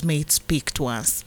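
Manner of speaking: ordinary talk, nothing marked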